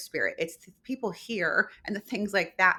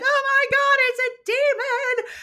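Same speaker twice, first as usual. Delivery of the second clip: loud, high-pitched panicked cry; hammed-up terrified shriek, drawn out at the end; mock freak-out; 9 dB louder, slower than usual. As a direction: play someone panicking at a ghost. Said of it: "they're freaking out".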